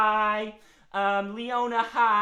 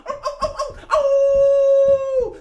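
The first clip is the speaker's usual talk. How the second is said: high yelps into a loud long howl; excited dog howl; 14 dB louder, much slower than usual. Pretend. Dog unable to calm down, howling. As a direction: hound yelps that swell into a howl. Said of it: "he's so excited".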